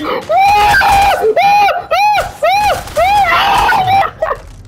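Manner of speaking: high squealing laugh; shrieking laugh. Real, not acted. Manic laughter while fleeing.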